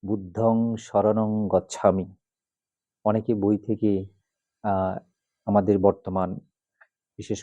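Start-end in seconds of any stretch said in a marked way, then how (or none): 0.0-2.1 বুদ্ধং শরণং গচ্ছামি read as spelled, short o vowels, slow; বুদ্ধং said budhong, শরণং as shoronong; book pronunciation. Shown as a demonstration. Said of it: "বইয়ে আছে".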